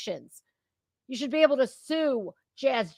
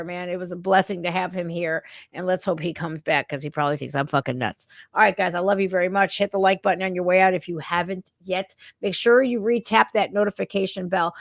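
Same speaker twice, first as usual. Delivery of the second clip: ordinary delivery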